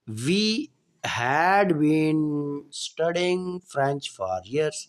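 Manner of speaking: each word stretched out slowly; very slow example sentence; very slow dictation. Shown as a demonstration. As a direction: drag it out for the class. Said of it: "teacher reading example sentence".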